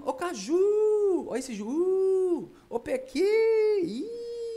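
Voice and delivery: high, loud hollers with long final vowels; calling out. Shown as a demonstration. Teacher shows calling the word, calling out. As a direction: call each word out, stretching the stressed ending. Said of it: "você chamando a palavra".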